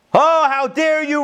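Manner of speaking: high, loud, slow outraged roar; roaring lion. A narrator's reading. The lion roars at the confessing sheep, outraged. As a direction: bellow it in shocked outrage. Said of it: "roared the lion".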